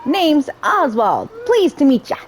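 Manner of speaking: a hint of a friendly cartoon fella; charming bystander. Oswald introduces himself to the player, lightly voiced and cheery.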